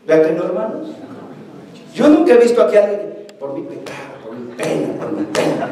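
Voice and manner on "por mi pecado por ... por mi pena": very low, hushed mumbling; mock penitent murmur. Preacher imitates breast-beating churchgoers for the congregation, mock penitent murmur. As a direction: murmur it like a rote confession.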